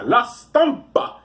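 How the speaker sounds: La Stampa high and drawn out, Italian-style; exaggerated Italian accent, slow and high; mock Italian accent. Shown as a demonstration. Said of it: "This is how you're supposed to do it".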